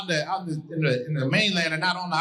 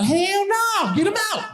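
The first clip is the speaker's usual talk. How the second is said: high, loud, indignant refusal; hell-no shout; 13 semitones higher, 3 dB louder, slower than usual. Retold or imitated. Answering people who ask about allergies, loud and high. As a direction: shout it like the idea is ridiculous.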